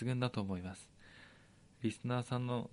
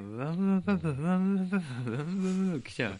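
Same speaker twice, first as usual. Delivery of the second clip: fast, high buzzing swarm noise; mock swarm noise; 7 semitones higher, 5 dB louder, faster than usual. Host mocking the Ibaraki gang rushing in, fast buzzing.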